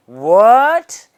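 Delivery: loud, high, rising mock "what?"; exaggerated puzzled question; uncomprehending. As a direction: imitate a baffled listener, swooping up in pitch. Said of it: "girls not even understanding".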